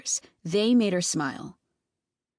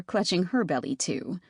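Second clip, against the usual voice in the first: ordinary delivery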